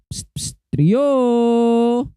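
psst, then a drawn-out call. Pretend. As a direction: call to a hiding friend, stretching the name. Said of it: "Tawag-tawagin natin siya". (patawag, pahaba ang pangalan)

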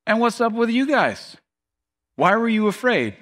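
high-pitched, mildly harsh challenge. Retold or imitated. Jesus wheels on the disciples, mildly harsh challenge. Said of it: "I wanna say harsh".

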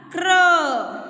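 "Crow" said as one drawn-out blended syllable, falling at the end; blend pronunciation demo. Shown as a demonstration. Teacher showing students how C R sounds, blend pronunciation demo. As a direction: model "Crow" with C and R run together, then a long vowel. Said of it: "What is the pronunciation of C R".

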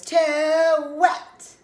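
high, loud, long-drawn protest; loud complaint. Acted. Child objects after the bath, loudly complaining. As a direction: stretch it into a big whine.

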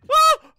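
high, loud scream; startled shriek. Real, not acted. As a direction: shriek at a jump scare. Jump scare in horror game.

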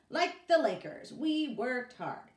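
loud, high, slow proud cheer; proud excited boast. Acted. Boy boasting to the boss, loud proud cheer. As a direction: crow about the hard work.

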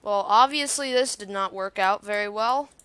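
ordinary delivery

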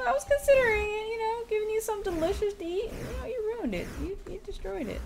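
high, fast playful scolding; mock scolding. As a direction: tease them like a cross parent. Mock scolding friend for eating.

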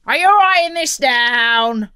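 bossy pirate question; pirate captain, demanding. A narrator's reading. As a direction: demand attention from the scribe. Captain checks on his scribe.

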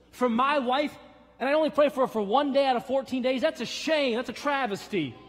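high, loud, fast rebuke from the pulpit; full-force preaching. Acted. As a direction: scold the congregation at full power.

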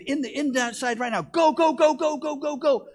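loud, high, rapid-fire urging; urgent shouting. Acted. Father hurrying his youngest daughter into the house, urgent shouting. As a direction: drive them inside with a shout.

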